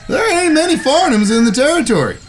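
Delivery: pleased as punch, pitched well up; eager cowboy brother. Acted. Brother hurrying his siblings to the stagecoach, high and eager.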